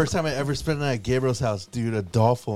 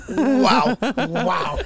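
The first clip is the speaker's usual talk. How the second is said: higher squeaky wow; mock high wow; 6 semitones higher than usual. Mimicking Dennis's wow.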